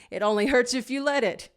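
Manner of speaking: laugh, then rush it out. A narrator's reading.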